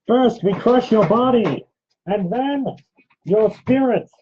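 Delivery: high, loud, slow villain voice through a mask; menacing masked villain, slow and loud; Bane. Retold or imitated. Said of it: "The Bane impression".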